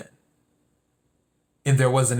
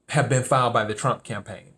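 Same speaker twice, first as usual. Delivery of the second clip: ordinary talk, nothing marked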